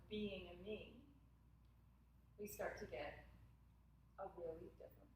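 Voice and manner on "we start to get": ordinary delivery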